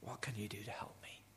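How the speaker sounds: soft, low, broken whisper. Retold or imitated. Third smoker asking the doctor for help, whispered. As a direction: plead in a barely voiced whisper.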